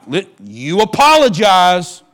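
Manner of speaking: loud slow parent's order; stern parent. Retold or imitated. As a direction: command it like a fed-up dad. Parent ordering kids to apologize.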